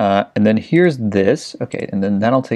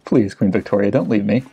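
ordinary delivery

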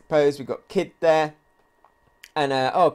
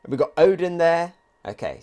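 ordinary talk

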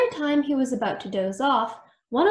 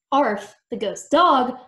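ordinary delivery, nothing marked

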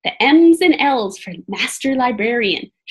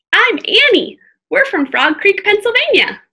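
put-on a bold kid urging caution; young girl voice; 9 semitones higher, 5 dB louder than usual. Annie's voice in read-aloud.

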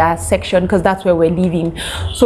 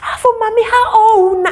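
hammed-up overjoyed stranger, much higher; scammer's exaggerated greeting; 12 semitones higher than usual.

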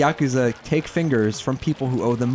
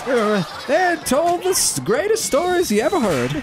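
put-on old grandma voice; 11 semitones higher than usual. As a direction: quaver out a granny war story. Playing war veteran grandma.

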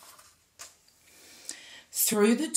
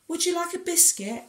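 much higher, quick, polite little question; polite offer; 9 semitones higher, faster than usual. A narrator's reading. Giant politely offers Tom a biscuit over cocoa. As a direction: offer it like a well-mannered host. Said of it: "asked the giant politely".

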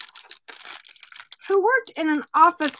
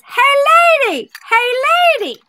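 high, loud bird squawk; talking parakeet; 10 semitones higher, 5 dB louder than usual. Acted. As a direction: holler like a cheeky pet bird. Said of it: "the bird says".

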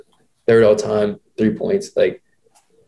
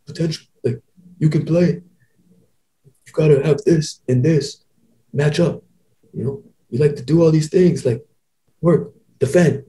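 imitating old coach; 5 semitones higher than usual. Voicing old coach Pete Carril.

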